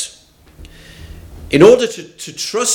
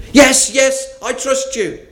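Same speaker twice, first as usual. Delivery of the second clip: grab at the lifeline; eager yes; 9 semitones higher than usual. Acted. Tourist eagerly answers God.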